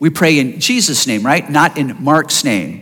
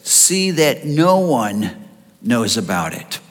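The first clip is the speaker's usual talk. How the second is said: a lightly voiced stern Jesus, low and slow; stern low warning; 6 semitones lower, 4 dB quieter, slower than usual. Jesus sternly warning the healed men, low and slow. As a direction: warn them quietly but firmly. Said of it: "Jesus sternly warned them".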